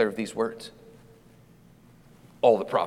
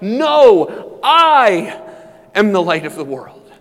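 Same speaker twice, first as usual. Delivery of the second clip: loud, high proclamation, huge "No" and "I"; crying out; 8 semitones higher, 10 dB louder, slower than usual. Acted. Jesus crying out over the arguing people, loud proclamation. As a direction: cry it out with long, ringing stress on "I". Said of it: "and he cries out".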